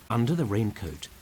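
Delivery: ordinary delivery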